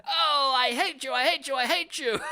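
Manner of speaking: high, loud, hammed-up shrieking tantrum; mocking tantrum. Mocking the husband screaming at his wife, high and loud. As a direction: scream it like a spoiled sore loser. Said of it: "and he's like".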